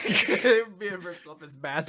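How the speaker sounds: slow laughing half-shout. Real, not acted. Host laughing out the big unmasking moment. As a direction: crack up while telling the reveal.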